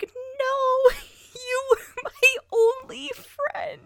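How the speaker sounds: fake-cry like a betrayed kid, half laughing; mock heartbroken wail. Host mocking his own betrayal by Atlas, hammed-up wail. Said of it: "I was just like".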